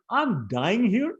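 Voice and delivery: mother yelling at Madhumangal to sit down; angry yell. Acted. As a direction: yell her frustration at the joker. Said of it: "yelled at him again".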